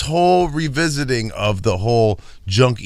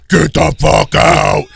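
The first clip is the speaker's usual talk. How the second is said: slow, menacing demon growl of a furious wife; slow demonic snarl, mock furious; deeper demon voice; 4 semitones higher, 6 dB louder, slower than usual. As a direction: drag out each word like a possessed wife. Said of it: "Her voice got deeper".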